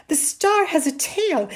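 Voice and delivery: put-on high, loud boyish wonder; excited. A narrator's reading. Boy tells mother of the star, excited. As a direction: announce a marvel, voice lifted. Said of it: "talking excitedly".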